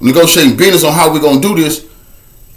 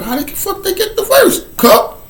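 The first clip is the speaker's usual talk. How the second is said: much higher, mocking whiny voice; mocking doubters; 3 dB quieter than usual. Viewers doubting him, in a high mocking voice. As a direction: squeak it like a skeptical listener. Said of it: "Some of y'all be like".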